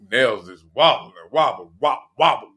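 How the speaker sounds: ordinary talk, nothing marked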